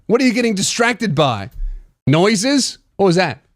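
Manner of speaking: normal talking voice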